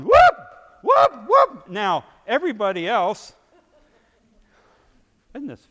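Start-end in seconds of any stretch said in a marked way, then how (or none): 0.0-1.7 high shrieking whoops, much louder; mock whoop. Preacher imitates girl at the salad bar, shrieking whoops. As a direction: yelp it out like she's caught by the Spirit. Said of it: "and she's going".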